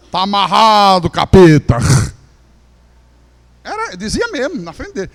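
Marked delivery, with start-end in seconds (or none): 0.1-1.8 loud, fierce binding of the devil; mock rebuke. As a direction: bark it out like an exorcism. (repreensão gritada ao capeta)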